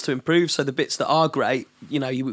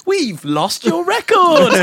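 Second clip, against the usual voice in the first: drawn-out, high, loud mock wail; mock bad news; 6 dB louder, much slower than usual. Producer pranking an artist with bad news, mock wail. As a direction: wail it slowly as fake disaster news.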